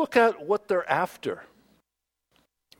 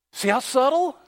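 ordinary talk

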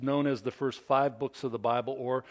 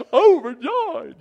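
high, loud, slow gushing joy; beaming with joy; 14 semitones higher, 14 dB louder, slower than usual. Retold or imitated. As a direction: burst out with over-the-top delight. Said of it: "say it like you're really overjoyed".

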